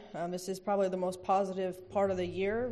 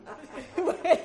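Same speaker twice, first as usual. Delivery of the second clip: high burst of laughter; 9 semitones higher, 4 dB louder than usual. Real, not acted. Laughs at own date mix-up.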